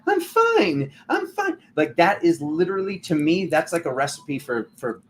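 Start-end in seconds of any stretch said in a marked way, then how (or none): 0.1-1.6 far higher, squeaky forced I'm fine; high fake-cheerful I'm fine; smiling through it. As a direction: chirp it with a fake smile hiding anger. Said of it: "just smiling through it and they're like".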